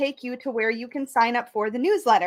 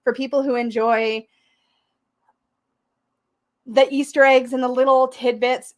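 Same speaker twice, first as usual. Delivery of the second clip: usual speaking voice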